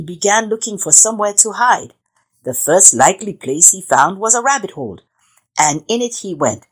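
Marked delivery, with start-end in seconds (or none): none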